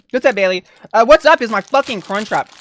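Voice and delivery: normal talking voice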